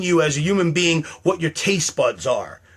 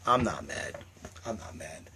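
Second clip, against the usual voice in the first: fading low mutter, much softer; muttered denial; 8 semitones lower, 13 dB quieter than usual. Real, not acted. Telling dropping viewers he's not mad, fading mutter. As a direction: trail off muttering it to yourself.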